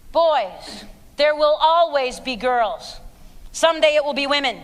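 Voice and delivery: usual speaking voice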